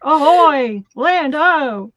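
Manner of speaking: loud, high hammy pirate. Pretend. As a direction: growl it out like a pirate captain. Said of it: "said that like a pirate".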